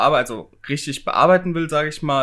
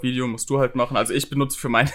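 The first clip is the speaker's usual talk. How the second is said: ordinary talk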